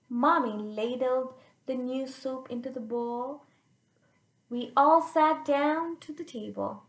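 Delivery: put-on lively read-aloud for kids; expressive children's story reading; storybook narration. A narrator's reading. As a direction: read it out like a picture book to children. Reads the picture book aloud.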